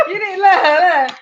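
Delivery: high, breathless laughter through the words; laughing so hard she can barely speak. Real, not acted. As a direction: crack up while talking. Laughing fit at cohost.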